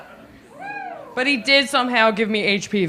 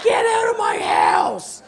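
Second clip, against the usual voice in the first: mock outrage; 8 semitones higher, slower than usual. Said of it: "They're like".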